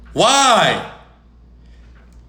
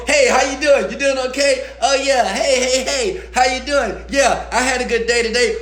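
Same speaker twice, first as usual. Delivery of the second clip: higher, mock-cheerful small talk; fake pleasantries; 7 semitones higher than usual. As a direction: imitate someone faking a good mood. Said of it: "All of you are faking it".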